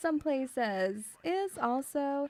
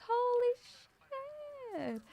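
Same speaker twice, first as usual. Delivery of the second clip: higher, slow stunned outburst; mock shock; 11 semitones higher, 3 dB quieter, much slower than usual. Reacting to chat stealing chocolate, drawn-out mock shock. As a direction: stretch out amazed disbelief.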